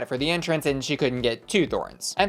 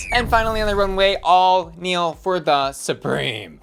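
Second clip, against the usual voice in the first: announcer hype; 3 semitones higher, 3 dB louder than usual. Acted. Hyped runway introduction, announcer style.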